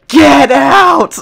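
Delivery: high, loud imitated yell of a teacher; retold scream. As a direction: belt it like the furious teacher. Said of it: "she ended up just screaming out".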